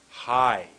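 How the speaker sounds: HIGH loud, drawn out, falling; pushed intonation. Shown as a demonstration. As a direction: push out a long HIGH. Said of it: "making your voice go high".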